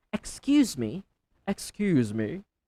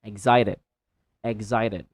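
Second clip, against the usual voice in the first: usual speaking voice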